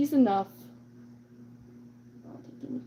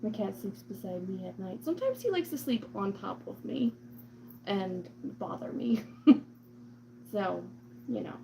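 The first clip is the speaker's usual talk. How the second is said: ordinary talk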